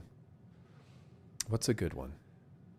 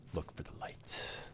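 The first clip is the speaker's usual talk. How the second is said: whispering; 15 dB quieter than usual. Acted. Quoting the game's motto.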